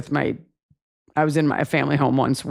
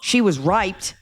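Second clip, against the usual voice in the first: raised, accusing sister voice; guilt-tripping sibling; 5 semitones higher than usual. Pretend. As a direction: throw it at the brother as a guilt trip. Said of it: "But the ultimate guilt trip".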